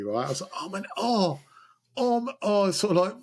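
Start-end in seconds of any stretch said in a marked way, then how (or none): 0.6-2.7 high, hammed-up groans of fake pain; faked pain groans. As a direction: moan like you just wrecked your knee, overacted. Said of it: "I had to fake an injury".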